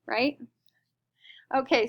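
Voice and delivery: ordinary talk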